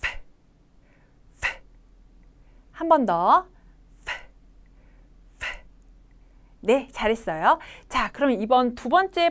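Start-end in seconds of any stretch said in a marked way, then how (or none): 0.0-1.6 F as pure air, no vocal-cord buzz; voiceless F sound. Shown as a demonstration. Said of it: "성대의 울림이 없어요". 4.0-5.5 F as pure air, no vocal-cord buzz; voiceless F sound. Shown as a demonstration. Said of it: "성대의 울림이 없어요".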